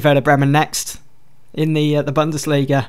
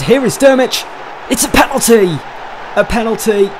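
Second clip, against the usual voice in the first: high and loud, rising to the penalty call; excited commentary; 7 semitones higher, 3 dB louder than usual. Real, not acted. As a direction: call it like a big match moment. Penalty chance in match commentary.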